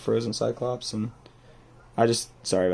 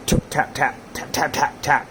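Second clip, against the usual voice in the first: short percussive taps, evenly repeated; hard taps; 3 semitones higher than usual. Retold or imitated. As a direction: imitate sharp, hard tapping. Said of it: "like, hard taps".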